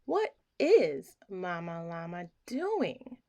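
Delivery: ordinary delivery, nothing marked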